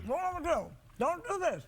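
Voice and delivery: words through a mouthful; mouth full. Real, not acted. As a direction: talk while chewing a big bite. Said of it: "That was a big bite".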